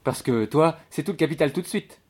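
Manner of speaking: disbelieving maid's retort; incredulous challenge. Acted. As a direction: jeer at his impatience. Maid scoffs at lodger's ambition.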